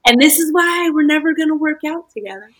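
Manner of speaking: tossing the line back at him; mock comeback voice; retold comeback. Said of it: "I was like".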